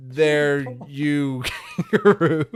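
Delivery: loud, slow attempt at Aussie sliding into Irish; Irish-sounding Australian accent. Voice actor posing as Paul Hogan, Australian accent gone Irish. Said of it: "did you know he did an Irish accent".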